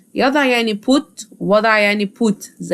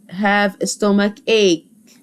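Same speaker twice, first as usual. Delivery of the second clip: "have a stomach ache" stretched out; slow teacher dictation; much slower than usual. Shown as a demonstration. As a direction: dictate it slowly. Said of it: "اسمها have a stomach".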